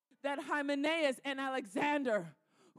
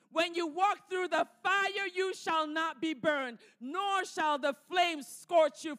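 closing prayer; 4 dB louder than usual. Acted. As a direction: pray it over them, ending hushed. Closing prayer over Isaiah 43.

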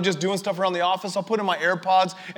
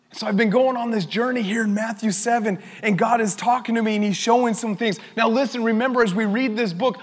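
ordinary delivery

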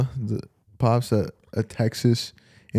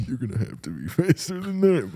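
put-on softer, higher old man; 4 semitones higher, 6 dB quieter than usual. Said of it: "commercial with the old man".

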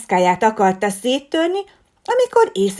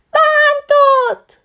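very high, loud whiny wail; fake whimpering; 10 dB louder than usual. A narrator's reading. The fox girl wails to the teacher, very high and loud. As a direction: put on crocodile tears. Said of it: "sírdogált Regina".

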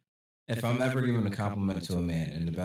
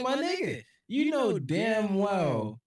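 much higher, slow, disbelieving; exasperated high pitch; 12 semitones higher, much slower than usual. Retold or imitated. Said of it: "I'm like".

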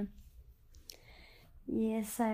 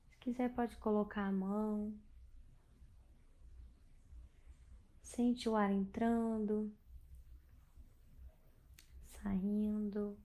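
guided meditation; 5 dB quieter than usual. Acted. Leads a bedtime meditation.

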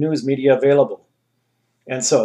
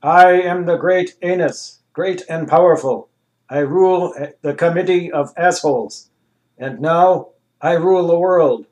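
a louder, lightly voiced grand Anus; the great Anus announcing itself; booming machine ruler; 5 semitones higher, 5 dB louder than usual. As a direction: proclaim it like a self-crowned tyrant. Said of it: "an answer was returned via electronic translation".

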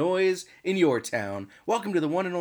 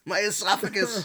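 laughing through a burning throat; laughing while choking up; 5 semitones higher, 4 dB louder than usual. Real, not acted. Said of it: "my eyes are watering".

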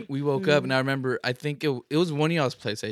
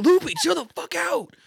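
alarmed yell; 5 dB louder than usual. Retold or imitated. Retold yelling at friend with gun.

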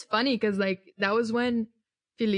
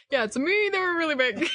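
imitating Brian; 8 semitones higher than usual. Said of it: "Brian's like".

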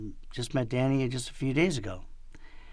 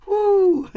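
very high, loud "woo"; mock leprechaun squeal; cartoon whoop; 9 dB louder than usual. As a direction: let out a piercing cartoon whoop.

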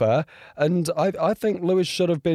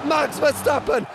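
excited live commentary; 14 semitones higher than usual. Real, not acted. Vlogger calling the title-deciding lap live, screaming excitement. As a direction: call the final lap like a frantic commentator.

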